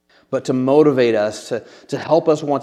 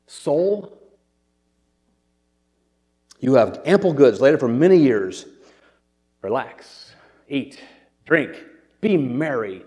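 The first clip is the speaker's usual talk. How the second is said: hammed-up self-address with long pauses; overly dramatic; slower than usual. Rich man talking to his own soul, over-the-top. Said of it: "He's a little overly dramatic".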